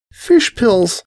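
high, weary dragon groan. A narrator's reading. Dragon complaining about the fish pills, groaning. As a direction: groan it out, fed up. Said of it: "the dragon groaned".

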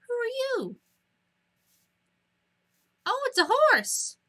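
way up high, surprised and delighted; suddenly squeaky, thrilled to find a horse; high excited squeal. Real, not acted. Greeting animals in game.

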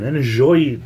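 put-on light French accent; a hint of a French crooner.

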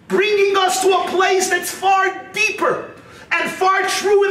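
high, sustained preacher's call; impassioned preaching. Acted. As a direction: proclaim it high and insistently. Preaching at raised pitch.

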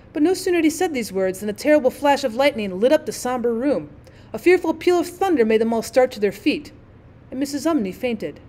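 usual speaking voice

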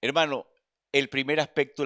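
fervent address to the brother; preaching. Acted. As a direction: lift it out to the congregation.

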